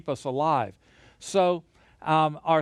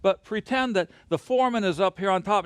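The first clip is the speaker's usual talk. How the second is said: normal talking voice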